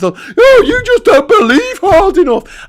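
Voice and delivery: very high, loud mock squeal; mocking moron voice. Morons scolding him for not believing, mocking high-pitched voice. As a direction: whine it shrilly like a gullible fool. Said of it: "a bunch of morons in the room".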